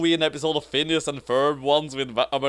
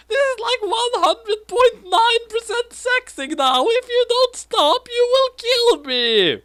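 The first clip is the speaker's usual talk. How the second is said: panicked squeal; 5 dB louder than usual. Acted. Misa's continued panic.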